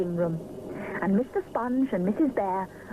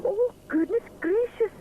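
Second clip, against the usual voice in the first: high, startled outcry; mild astonishment; exclaiming; 12 semitones higher than usual. Acted. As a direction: sound truly shocked. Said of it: "exclaims Mister Sponge".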